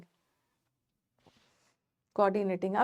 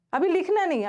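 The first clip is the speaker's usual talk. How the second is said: high and loud, a sharp stop to the class; firm loud warning; 6 semitones higher, 5 dB louder than usual. Real, not acted. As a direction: call out so nobody writes yet. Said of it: "teacher stops class writing". (ऊँची और तेज़ आवाज़ में रोकना)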